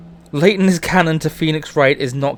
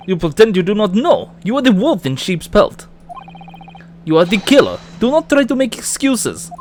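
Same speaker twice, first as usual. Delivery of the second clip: put-on Russian accent; 5 semitones higher than usual. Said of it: "if you wanna do a Russian accent".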